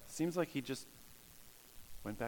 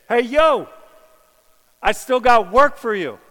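loud, much higher, pressing call to get back to work; loud urging; 6 semitones higher, 16 dB louder than usual. Acted. Jesus calling Peter away from fishing, loud and urgent. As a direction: shout the charge at Peter like a coach.

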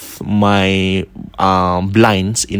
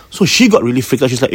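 normal talking voice

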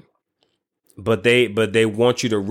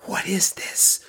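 shocked whisper; 9 semitones higher than usual. Retold or imitated. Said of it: "I was like".

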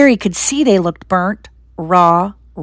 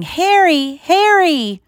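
much higher call from far away; distant calling; 10 semitones higher, slower than usual. Acted. Voices in Harry's head calling his name from afar. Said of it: "in Harry's own head crying".

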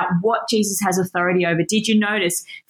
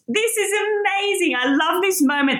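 high, thrilled exclamation; excited; 9 semitones higher than usual. Real, not acted. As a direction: gush with wonder. Preacher thrilled by the scene.

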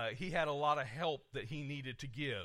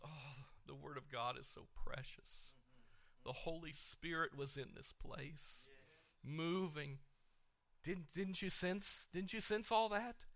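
tearful mentor; 8 dB quieter than usual. Retold or imitated. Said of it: "leaving in tears".

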